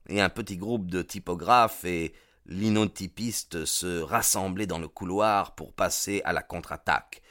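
usual speaking voice

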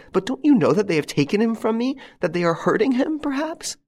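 high, loud desperate plea; mother terrified for her son, frantic plea. Acted. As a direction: beg in rising panic.

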